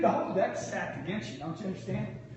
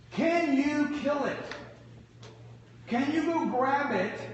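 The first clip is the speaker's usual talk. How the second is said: slow; 5 semitones higher, slower than usual. Real, not acted.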